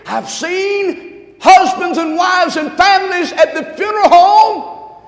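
rising, slow, loud preacher's cry; slow climbing pulpit shout; preaching at full force. Real, not acted. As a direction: lift the congregation with the line.

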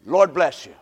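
low, gentle blessing. Retold or imitated. Boaz greeting his workers, low and gentle. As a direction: greet them kindly, like a good boss. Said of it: "You know how he greeted them?".

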